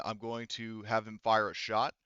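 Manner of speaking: normal talking voice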